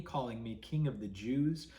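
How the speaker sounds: plain reading voice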